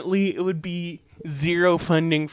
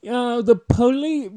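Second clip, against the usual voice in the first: put-on higher, louder stab at a Boston accent; a hint of hammed-up Boston; 5 semitones higher, 5 dB louder than usual. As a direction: try out a mock Boston accent, breaking off. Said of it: "I should do a Boston accent".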